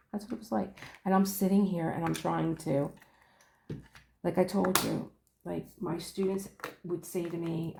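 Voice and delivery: ordinary talk, nothing marked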